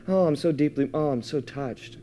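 lightly mocking a sweet, touched Jesus; mock sentimental. Preacher mocking the soft reading of the verse, hushed and gushing. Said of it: "He's not just".

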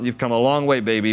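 normal talking voice

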